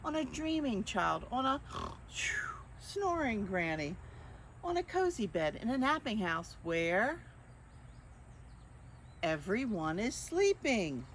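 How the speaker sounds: lightly lilting picture-book narration; reading to children. A narrator's reading. Librarian reading the book to children, gentle storytime. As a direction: read it gently to little listeners.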